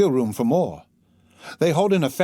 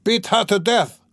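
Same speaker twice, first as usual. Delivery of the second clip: furious cry; 9 semitones higher than usual. Acted. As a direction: scream it with drunken fury. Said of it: "cried Mikolka".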